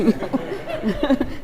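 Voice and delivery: laugh. Real, not acted. Laughing at own joke.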